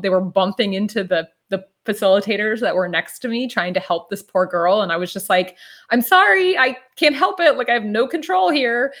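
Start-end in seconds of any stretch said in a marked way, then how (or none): 5.9-8.9 much higher, louder, laughing apology that settles down; giggly apology. Retold or imitated. To facilitators bumped by her arms, laughing helplessly. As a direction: squeal the sorry helplessly, then come down.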